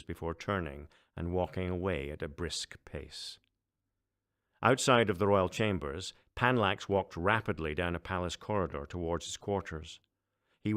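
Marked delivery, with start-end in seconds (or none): none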